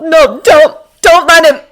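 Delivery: loud, high, frantic pleading; panicked self-urging shouts; desperate begging. A narrator's reading. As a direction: beg yourself urgently, rising to a cry. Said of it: "begging himself".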